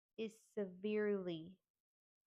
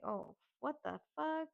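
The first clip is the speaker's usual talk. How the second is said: pitched higher, retold shock; a startled, higher outburst; shocked exclamation; 7 semitones higher than usual. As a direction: blurt it out in disbelief. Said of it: "we're like".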